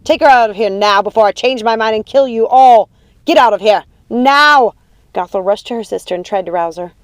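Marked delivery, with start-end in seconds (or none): 0.0-4.7 loud, enraged order; full-voiced threatening yell; furious command. A narrator's reading. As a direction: shout them out, rising to the last word. Mother orders them out, threatening.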